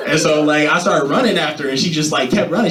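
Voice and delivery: ordinary talk, nothing marked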